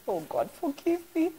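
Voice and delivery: mock plea.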